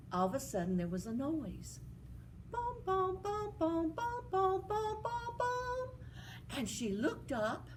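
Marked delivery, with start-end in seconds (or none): 2.5-5.9 mock magic booms climbing higher; sing-song booms rising step by step. Magic sound effect rising.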